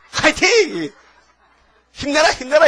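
very high, loud mock fighting; mock cheer. Stranger cheering an unknown pastor, high mocking shout. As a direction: toss it off like a cheerleader.

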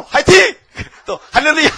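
high, loud laughing cheer; cheering shout. Retold or imitated. Caller texting cheers to the pastor, high laughing shout. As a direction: whoop it with a laugh.

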